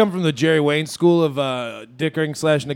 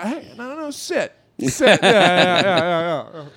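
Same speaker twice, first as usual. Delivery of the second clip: calling back. Acted. Acting out a haggling walkout.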